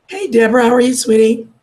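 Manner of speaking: ordinary talk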